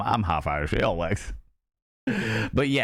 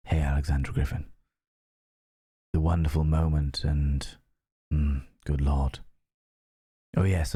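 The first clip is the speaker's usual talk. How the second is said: lightly sultry lounge voice; mock sultry voice; 5 semitones lower than usual. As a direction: purr it low and teasing. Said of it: "my delightful sultry tones".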